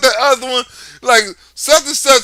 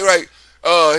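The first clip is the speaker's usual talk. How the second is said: ordinary delivery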